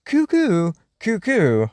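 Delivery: imitating a cuckoo clock. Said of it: "spring to life and chime in with the telltale cuckoo".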